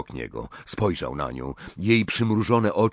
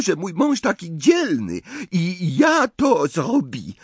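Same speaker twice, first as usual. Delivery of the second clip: put-on higher, louder, indignant French accent; angry Fleur; 12 semitones higher, 4 dB louder than usual. A narrator's reading. Fleur angrily defends Bill in her French accent. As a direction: snap back at her future mother-in-law. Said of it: "dodała ze złością".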